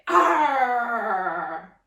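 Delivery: a loud pirate arr on the out-breath; pirate growl. Acted. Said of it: "we're gonna say".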